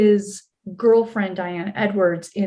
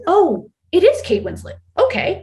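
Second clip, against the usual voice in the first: higher-pitched, amazed recognition; star-struck realisation; 9 semitones higher, 4 dB louder than usual. Retold or imitated. Extra realising the star is really there, surprised. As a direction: replay the surprise landing.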